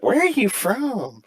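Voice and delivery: very high, slow mock question; imitating locals. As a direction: mimic curious locals way up high. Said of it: "They're like".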